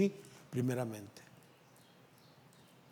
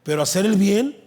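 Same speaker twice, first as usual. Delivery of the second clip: booming pulpit proclamation, pitch raised; loud preaching; 7 semitones higher, 13 dB louder than usual. Acted. Preacher booming scripture at the congregation. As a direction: thunder the verse out to the church.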